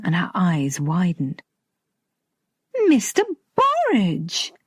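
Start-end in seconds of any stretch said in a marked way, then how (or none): 2.9-4.5 sudden high surprised exclamation; startled recognition, pitched up. Acted. Stacey surprised to see him.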